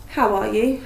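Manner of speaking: plain reading voice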